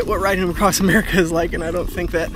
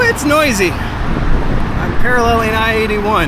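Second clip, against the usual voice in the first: raised voice; 4 semitones higher, 5 dB louder than usual. Real, not acted. Talking beside loud highway traffic.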